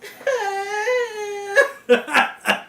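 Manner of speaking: a high laugh that breaks into mock sobbing; mock laugh-cry, pitched high; imitated crying laugh. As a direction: laugh until it turns to crying. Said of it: "he's just crying".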